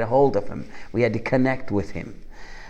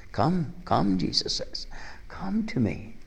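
higher, softer inviting call; tender invitation; 6 semitones higher, 7 dB quieter, slower than usual. Retold or imitated. Preacher voicing Jesus gently calling the weary to come. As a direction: coax them gently to come.

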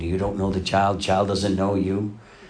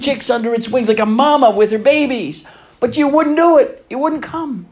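much higher and louder, a pleading lament; Jesus lamenting Jerusalem; 13 semitones higher, 8 dB louder than usual. Retold or imitated. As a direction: cry out like a grieving father over a city that refused him. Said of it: "He said".

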